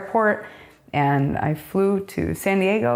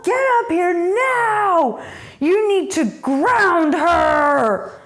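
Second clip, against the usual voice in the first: much higher and louder, slow shrill yelling; stepmom's shrieking call; 5 dB louder, slower than usual. Retold or imitated. Stepmother yelling for the dad to ground her, shrieking. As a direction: mimic a furious stepmother screaming upstairs. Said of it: "And she says".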